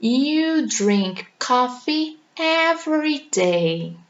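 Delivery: slow, each word clear; teacher modelling a routine example; slow model sentence. Shown as a demonstration. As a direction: read it out slowly to learners. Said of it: "eu vou dizer que".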